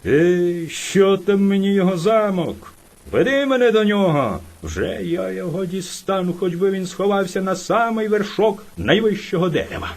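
loud, defiant bear bragging; furious boasting bear. Acted. Enraged bear bragging to the hare he will catch his rival, loud. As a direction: roar out the boast of a bully.

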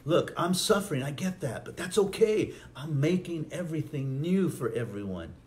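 high, emphatic, eager reassurance; excited Jesus. Acted. Jesus on the cross road reassuring Mary, excited. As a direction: insist on it with rising enthusiasm.

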